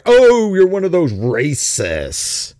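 slow, drawn-out mock outrage; mock accusation. Host mimicking people who cry racism, mock accusation. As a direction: stretch it into a scandalized, sneering charge.

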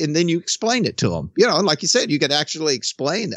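normal talking voice

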